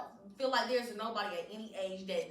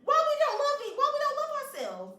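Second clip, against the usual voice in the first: high, loud playful disbelief; mock dismay; 14 semitones higher, 5 dB louder than usual. Scolding her co-host for self-talk, with mock dismay. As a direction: pretend to be stunned, teasingly.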